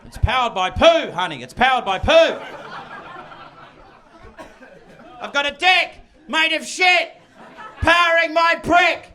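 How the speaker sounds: loud, high sing-song bragging; gleeful shouted boast. Retold or imitated. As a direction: crow it like a proud idiot.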